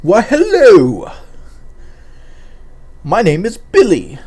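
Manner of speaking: slow, exaggerated silly voice; hammed-up goofy kid voice; goofy character voice. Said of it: "do the Seed Anders thing".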